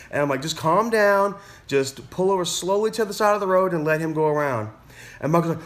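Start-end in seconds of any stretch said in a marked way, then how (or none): 0.4-4.7 raised, insistent reassurance; urging calm. Retold or imitated. Teacher telling learner to let a car pass, high and insistent. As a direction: talk a nervous learner down, pitched up. Retold instructor steadying a panicking learner.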